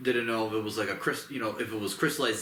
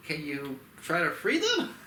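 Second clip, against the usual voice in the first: little ape pleading; 7 semitones higher than usual. Retold or imitated. Voicing little Kong pleading.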